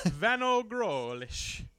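slow, high, loud Italian-style name; mock Italian accent. Shown as a demonstration. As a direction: stretch the name in hammy Italian. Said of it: "Van Grohl in Italian".

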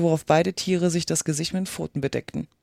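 ordinary delivery, nothing marked

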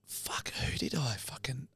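slow unvoiced whisper; hungover whisper. Waking after a drunken night, whispering in dread. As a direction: breathe it out in dread. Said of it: "I woke up, I'm like".

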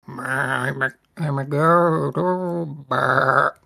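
bleating goat, drawn-out wobbly words. Acted. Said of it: "then a goat is like".

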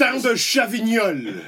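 Crottin de Chavignol said slowly in French; slow French accent; French pronunciation. Shown as a demonstration. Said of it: "I will say something in French".

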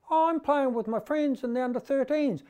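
put-on high squeaky boy's voice; squeaky kid voice. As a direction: pitch it up like a squeaky young kid. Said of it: "in the squeaky voice".

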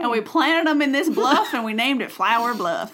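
badly faked Texan twang; mock Texan accent. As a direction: play a hammy Southern storyteller. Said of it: "that's not a Texan accent".

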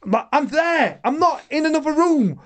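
high, protesting outburst; retold indignation. Boxer hearing himself insulted in his presence, indignant. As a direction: re-enact an affronted protest.

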